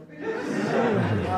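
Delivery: short chuckle; amused laugh. Real, not acted. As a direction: laugh at how easy it was. Laughs at the opponent.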